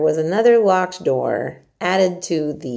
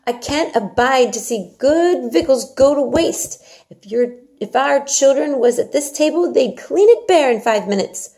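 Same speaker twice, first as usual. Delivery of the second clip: Yorkshire maid Martha; 8 semitones higher, 6 dB louder than usual. Acted. Martha urges Mary to eat her porridge, hearty and coaxing. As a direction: coax the child like a bossy country servant.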